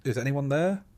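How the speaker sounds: high, loud call with a long rise. A narrator's reading. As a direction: call into the distance for any reply. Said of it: "The voice again".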